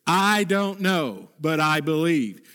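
spoken slowly, each word weighed; slow it down and let it land; measured, unhurried statement of faith; slow, deliberate refrain. Shown as a demonstration. Refrain slowed right down.

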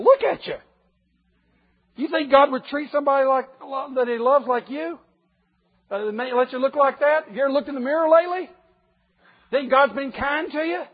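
high, loud sneering taunt as the tempter; mocking devil. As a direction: jeer at the listener like the devil sizing him up. Preacher voicing the devil's taunts.

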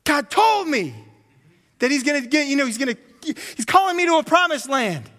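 high and loud, like a preacher at a rally; rally crowd call. Pretend. Abraham at his rally, shouting high and loud. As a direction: pump up the crowd on big screens. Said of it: "And he's like".

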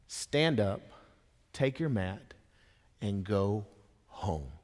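gentle command. Acted. Jesus commands the healed man.

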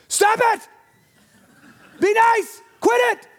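put-on loud high yell at kids; dad yelling. Dad on the couch yelling at kids to stop. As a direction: bellow it down the basement stairs. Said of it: "I yell first".

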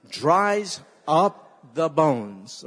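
loud and very slow, every word weighted; a slow, heavy memory line for the congregation; slow emphatic recitation. Shown as a demonstration. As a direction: spell it out for the church to echo. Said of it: "Say that".

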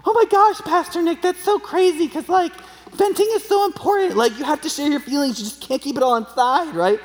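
high gushing parody of an excited churchgoer; valley girl parody. Retold or imitated. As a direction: ham up a breathless gushing young woman. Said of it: "the average person is gonna be like".

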